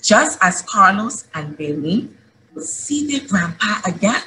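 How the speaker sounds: put-on rally the kids with joyful hope; eager, rising pulpit appeal; rousing children's sermon. Closing exhortation to children.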